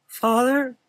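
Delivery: put-on high, trembling boy. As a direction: quaver it out. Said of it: "with a trembling voice".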